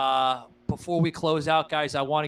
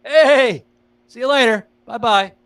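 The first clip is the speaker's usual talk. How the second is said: loud, high-pitched sarcastic goodbye; cheeky farewell yelled higher and louder; mock taunt; 9 semitones higher, 11 dB louder than usual. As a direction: wave off the rival with a jeering shout. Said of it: "be like".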